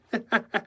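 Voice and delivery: high chuckle of pleasure; pleased laugh. Acted. Decorator delighted with his pretense, pleased laugh. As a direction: laugh at your own joke. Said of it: "grinned with pleasure as he related it".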